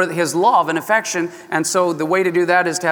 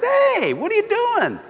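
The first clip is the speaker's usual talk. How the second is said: sharp high alarmed cry. Retold or imitated. Jacob startled as Laban comes at him, high and sharp. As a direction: stop him in his tracks. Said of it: "and Jacob says".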